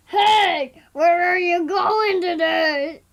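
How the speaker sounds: put-on child doing a loud, slow booming dad voice; daddy voice. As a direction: plays her father, loud and slow. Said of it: "That's [name]'s daddy voice".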